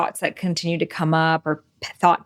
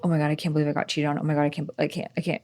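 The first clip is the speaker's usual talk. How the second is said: retold panic; 4 semitones lower, 6 dB quieter, much faster than usual. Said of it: "all I was thinking about was".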